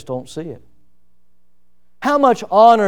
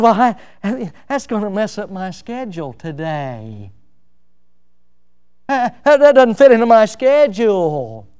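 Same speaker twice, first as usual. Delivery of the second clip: a hint of a whiny excuse-maker; reluctant excuse; 2 semitones higher than usual. Preacher mimics a churchgoer dodging helping the sick, grumbling. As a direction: grumble about your schedule.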